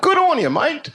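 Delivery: mock Australian. Comic echoing a fan's accent, mock Australian. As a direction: toss off an Aussie cheer.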